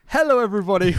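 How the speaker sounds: high-pitched podcast greeting; put-on host voice. Real, not acted. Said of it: "that tone of voice".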